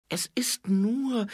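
hesitate, stretching the last word; hesitant. A narrator's reading. Angua explaining difficult things to Nobby, hesitant. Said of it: "Angua zögerte, was oft geschah".

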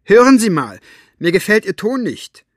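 high, loud indignant rebuke; snapping. A narrator's reading. Elinor confronting the armed man about his tone, snapping. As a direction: lash out at him, loud and high. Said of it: "fuhr Elinor Buster an".

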